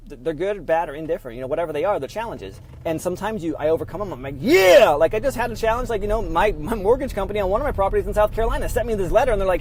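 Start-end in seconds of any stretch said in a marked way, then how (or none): 4.4-4.9 loud, high cheer; triumphant yell. Retold or imitated. Vlogger retelling beating a challenge with a loud, high cheer. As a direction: shout it like you won.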